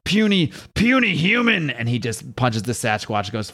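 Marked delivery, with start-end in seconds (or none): 0.0-1.7 high gruff monster taunt; Hulk voice. Acted. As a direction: sneer it like the Hulk.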